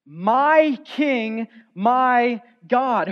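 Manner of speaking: loud declaration of my King, my God; crying out. Shown as a demonstration. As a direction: proclaim it as a cry to God. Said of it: "Notice how he cries out".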